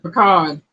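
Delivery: "pecan" said as puh-CON; "pecan" pronounced puh-CON; regional pronunciation. Shown as a demonstration. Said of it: "it is pronounced P U H C O N".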